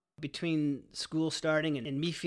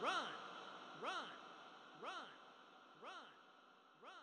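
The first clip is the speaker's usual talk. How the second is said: louder, higher shouted chant of run; frantic shouting; 10 semitones higher, 4 dB louder than usual. Retold or imitated. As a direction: yell it over and over in panic. Frantic warning to flee.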